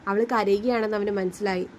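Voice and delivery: ordinary delivery, nothing marked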